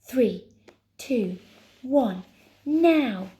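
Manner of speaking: loud, measured count rising to NOW; countdown call. Acted. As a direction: count them in forcefully. Counting down to the push.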